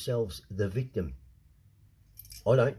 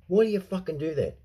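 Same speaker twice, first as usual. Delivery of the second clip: angry retold tirade; 7 semitones higher than usual. Telling the agent off, furious. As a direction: lay into him, then clip it off. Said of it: "I said to him".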